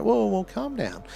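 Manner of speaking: pitched high, soothing whoa; high mock soothing; calming down. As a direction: lift the whoa high and ease them down. Calming an overreacting person.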